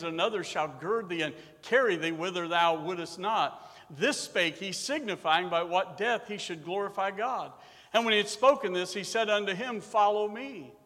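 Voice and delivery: loud, measured King James reading; solemn scripture reading. Acted. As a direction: read it like holy writ from the pulpit. Reads scripture aloud.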